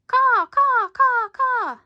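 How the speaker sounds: high loud bird caws; squawking puffins. Acted. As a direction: caw like panicked seabirds.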